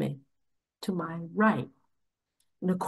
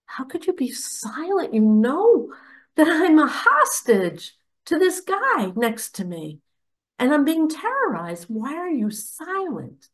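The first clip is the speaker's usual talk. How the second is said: higher-pitched, incredulous inner plea; retold questioning, pitched up in disbelief; quizzical disbelief; 6 semitones higher than usual. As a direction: demand an answer in retold bewilderment. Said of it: "I'm looking at him quizzically, thinking".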